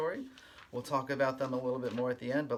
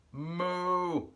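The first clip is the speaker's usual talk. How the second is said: cow moo; 7 semitones higher, 7 dB louder than usual. Acted. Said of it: "and the cow says".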